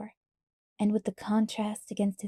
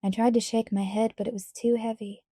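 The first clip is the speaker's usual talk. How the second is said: plain reading voice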